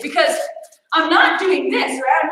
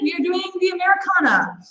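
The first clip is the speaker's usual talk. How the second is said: normal talking voice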